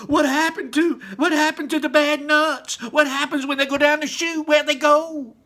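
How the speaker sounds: high, loud frantic questions; panicked shrieking. Acted. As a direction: keep shrieking for your daughter. Panicked mother questions Wonka.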